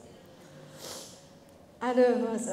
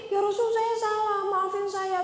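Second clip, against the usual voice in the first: whiny apology; 6 semitones higher than usual. Pretend. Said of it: "Tapi Rasul juga nggak begini".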